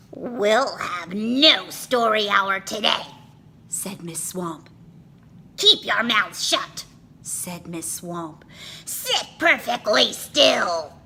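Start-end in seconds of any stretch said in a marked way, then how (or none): 0.1-3.1 louder, harsh decree; stern witch teacher. A narrator's reading. Witch teacher cancelling story hour, harsh stern voice. 5.6-6.8 louder, harsh command; stern order. A narrator's reading. Witch teacher silencing kids, harsh order. 8.8-10.9 louder, harsh command; stern order. A narrator's reading. Witch teacher ordering stillness, harsh order.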